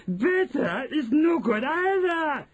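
high slow shrieking witch. Acted. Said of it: "shrieked the Grand High Witch".

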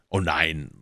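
usual speaking voice

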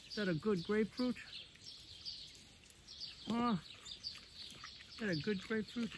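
tender coo over a treat; soft pet talk. Real, not acted. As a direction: coo at him softly and high. Baby talk to pet pig.

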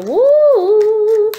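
loud, high whoop of surprise; startled joyful whoop; excited whoop. Real, not acted. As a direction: let out a thrilled woo. Sudden energy reaction during reading.

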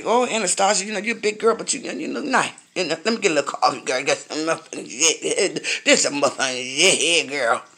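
mock a guy hitting on her in a store; imitating a flirty man. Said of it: "if I would have met somebody today, say".

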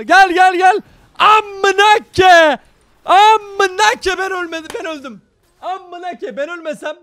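loud, high, drawn-out desperate shouting; frantic yell. Real, not acted. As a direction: scream at the screen in the clutch. Teammate fight, gamer screams. (yüksek, uzatılmış çaresiz bağırış)

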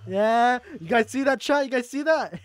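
high-pitched excited crowing; excited boasting. Real, not acted. Streamer celebrating a stunt for chat, excited boasting. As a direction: show off to the audience, pitch up.

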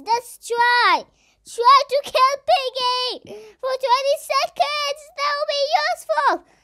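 high, loud desperate calls; panicked pleading. Real, not acted. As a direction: beg for help in a panic. Trapped, pleading for help.

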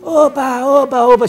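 high, loud, gleeful oba; euphoric cheer. Acted. Euphoric king cheering that Diógenes will take a gift, high and loud. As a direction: cheer like a king who finally gets his way. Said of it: "o rei ficou eufórico".

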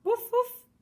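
very high, loud yappy woof woof; happy little dog bark. Acted. Dog barking at the end of the chase, high loud yap. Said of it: "a fait le chien".

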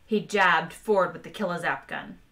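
plain reading voice

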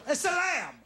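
sudden high-pitched comic cry; mock outburst. Comic scolding costumeless trick-or-treaters, mock outburst. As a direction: burst out high, cutting off the scolding.

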